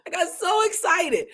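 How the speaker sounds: high-pitched excited burst. Real, not acted. Preacher tells listeners about her Bible discovery, excited.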